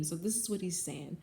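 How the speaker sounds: usual speaking voice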